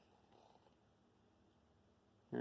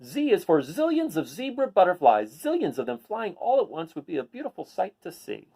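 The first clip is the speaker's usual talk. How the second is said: picture-book read-aloud voice; reading to children; 6 semitones higher, 5 dB louder than usual. Acted. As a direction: read it to a class of kids.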